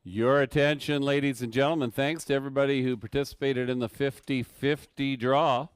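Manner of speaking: raffle caller. Acted. PA raffle announcement.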